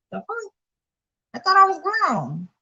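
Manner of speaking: high-pitched, incredulous complaint; indignant protest. Real, not acted. Vlogger objecting to being silenced as a grown adult, indignant.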